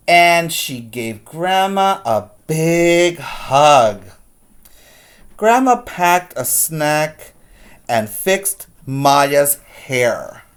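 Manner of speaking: put-on lively storybook reading for kids; read-aloud story voice. A narrator's reading. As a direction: read it like a teacher to the class.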